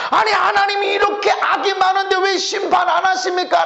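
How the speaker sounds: shouting the question at God; indignant complaint. Retold or imitated. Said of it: "라고 하는 것처럼 보이지만". (하나님께 따지듯 외치는 항의)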